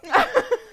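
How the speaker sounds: loud, high cackle; laughing burst. Real, not acted. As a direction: crack up suddenly. Cracks up over name again.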